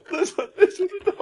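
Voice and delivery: high, giggling through words; laughing hard. Real, not acted. Prankster cracking up.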